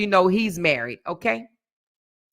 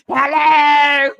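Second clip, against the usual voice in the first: high, loud shouted hello; mock phone shout, much higher; imitating Miss Linda's hello; 10 semitones higher, 8 dB louder, much slower than usual. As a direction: bark the hello into the phone like a brash caller. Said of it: "She said".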